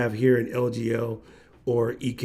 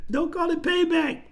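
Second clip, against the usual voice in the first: laughing. Real, not acted. Laughing reply to a joke.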